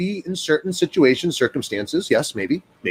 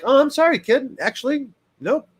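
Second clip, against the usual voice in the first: gentle correcting parent; 9 semitones higher than usual. Retold or imitated. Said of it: "Or are you like".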